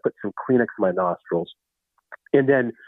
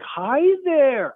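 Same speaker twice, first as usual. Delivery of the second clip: high-pitched greeting to a simpleton; over-friendly greeting; 14 semitones higher than usual. Acted. Store staff greeting Rusty, over-friendly and high. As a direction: greet in an exaggerated high singsong.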